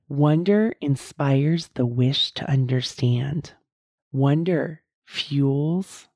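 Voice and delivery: very slow, with long pauses between phrases; slow deliberate reading. Shown as a demonstration. Host rereading the wonder definition for listeners, very slow. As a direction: linger on each phrase of the definition. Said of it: "read that definition again".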